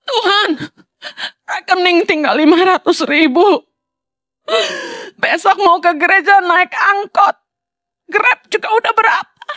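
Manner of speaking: mock tearful prayer. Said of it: "Kita nggak perlu nangis".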